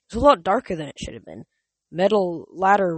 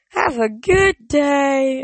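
cracking high farewell voice; 8 semitones higher, 5 dB louder, much slower than usual. Real, not acted. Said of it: "I apologize for my voice cracks".

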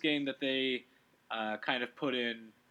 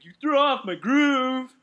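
loud, high, drawn-out mock outrage; outraged movie character; 10 semitones higher, 9 dB louder, slower than usual. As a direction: belt out the quote slowly, much higher and louder. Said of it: "it's gonna be like".

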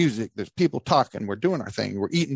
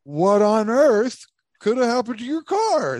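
high, drawn-out mock astonishment; mock Dan; 8 semitones higher, 3 dB louder, slower than usual. Storyteller mocking his friend's version of the question, exaggerated astonishment. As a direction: ham up the question slowly in a high voice. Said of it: "I remember".

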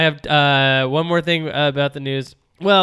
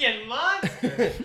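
laughing echo; 11 semitones higher, slower than usual. Real, not acted. Echoing with a laugh.

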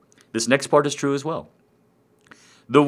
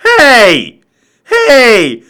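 very high, loud mock complaint; outraged audience; 8 dB louder, slower than usual. Audience protesting to the comic who throws back, high yell. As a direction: yell it high, indignant and whiny. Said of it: "if the audience is like".

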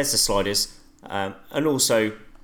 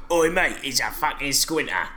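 a loose, hammed-up Cockney accent; mock Cockney geezer slagging off a mate; 4 semitones higher than usual. Friend trying a Cockney impression for the podcast, Cockney accent. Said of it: "Cockney rhyming slang".

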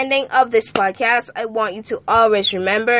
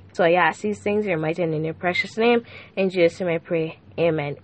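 soft, lower, fast-flowing prayer; hushed prayer; 4 semitones lower, 4 dB quieter than usual. Acted. Host praying softly for drifting teenage listeners. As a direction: pray quietly over the listeners.

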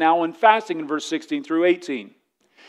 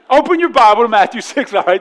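scolding dad; 4 semitones higher, 7 dB louder, faster than usual. Pretend. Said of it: "Just like Dad's scorning you".

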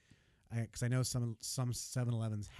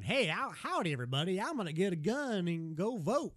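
mock American accent; 8 semitones higher than usual. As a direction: imitate the English doing a generic cowboy American accent. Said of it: "they do, like, the g-, generic one".

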